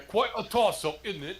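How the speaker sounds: high-pitched put-on English accent; a touch of hammed-up English accent; mock English accent. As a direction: toss it off in a playful English accent. Mock English reply about England.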